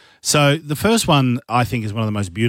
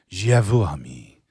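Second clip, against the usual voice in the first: tender French; 5 semitones lower, slower than usual. Shown as a demonstration. Host showing French is beautiful, slow and tender. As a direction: linger sweetly over the French. Soft take set against harsh German.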